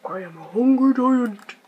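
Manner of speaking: lightly voiced giant, slowed; a hint of a hungry giant. Reading the giant's lines to the player, lightly voiced giant. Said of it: "A giant voice says".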